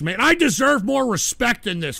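usual speaking voice

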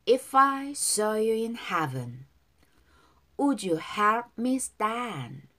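very slow, stretched-out lyric lines; slow pronunciation model. Shown as a demonstration. Teacher reads the verse for learners to follow, slow and drawn out. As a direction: draw out every word for repeating. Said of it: "이 절 발음 따라서".

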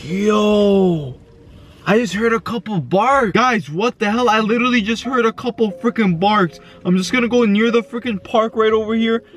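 ordinary delivery

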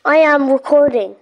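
loud, firm shout that he is recording; loud insistence. Real, not acted. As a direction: call it out loud so they hear. Telling other player he is recording.

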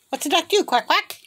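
high, chirpy bird prompt; playful prompting. Real, not acted. Owner prompting a bird to quack, high playful voice.

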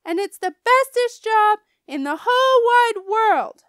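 high, loud boastful shout; defiant yell. A narrator's reading. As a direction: keep yelling, insist on it. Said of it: "I yelled".

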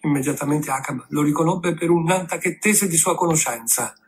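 usual speaking voice

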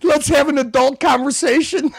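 high-pitched mock plea; mocking echo. Host parroting the peacemaker's plea, mocking.

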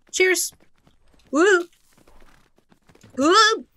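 high, loud squeals of glee; drawn-out excited whine. Real, not acted. Outro, can't exit game.

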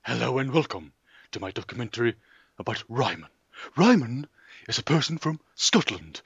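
measured mock-documentary host delivery; documentary presenter. Host opens a documentary about Ryman, documentary presenter. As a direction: introduce the subject and expert, deadpan.

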